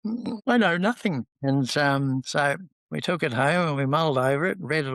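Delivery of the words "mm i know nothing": high, innocent mock denial; coy wife. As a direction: play dumb like a guilty wife. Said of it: "And she's going".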